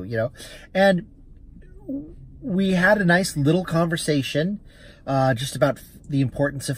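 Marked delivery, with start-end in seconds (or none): none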